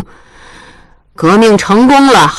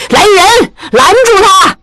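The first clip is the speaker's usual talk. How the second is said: calling out; 12 semitones higher, slower than usual. Acted. Old lady calls for servants to stop her. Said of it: "王老太太大叫".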